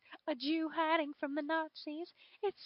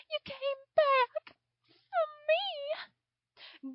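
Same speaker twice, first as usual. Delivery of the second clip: high, slow, breaking with happy tears; tearful joy; 10 semitones higher, much slower than usual. Acted. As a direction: marvel through tears of relief. Anne rescued, overjoyed.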